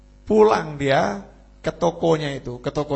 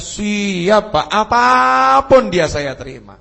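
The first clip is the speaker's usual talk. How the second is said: loud, high, emphatic acceptance; high, drawn-out pledge; eager vow; 6 semitones higher, 7 dB louder, slower than usual. Acted. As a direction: swear it eagerly to your future father-in-law. Suitor vows to accept any bride.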